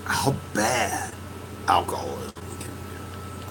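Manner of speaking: hushed, breathy whisper on how bad. Real, not acted. Vlogger whispering about alcoholism's toll. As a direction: drop to a whisper to stress it. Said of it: "whispered emphasis on how bad".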